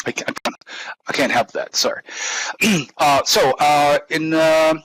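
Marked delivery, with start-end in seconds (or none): 2.1-2.9 gruff throat clear; throat clearing grunt. Real, not acted. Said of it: "grunting noises coming".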